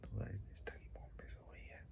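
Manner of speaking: hushed whisper so no one wakes. Real, not acted. Vlogger whispering to viewers while family sleeps, whispered. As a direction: whisper it, family asleep nearby.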